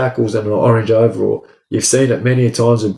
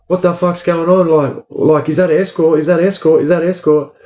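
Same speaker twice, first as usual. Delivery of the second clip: imitating panicked inmates, pitched higher; retold frantic questions, higher voice; alarmed inmates; 6 semitones higher than usual. Other inmates panic over escort.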